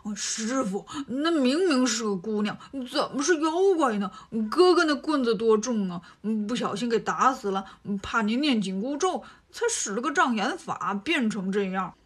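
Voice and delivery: Bajie's put-on voice, sly and wheedling; sly instigating Bajie. Bajie sidles up to the master, slyly stirring trouble. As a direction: stir up trouble with a sly whine. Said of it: "就在旁边挑唆".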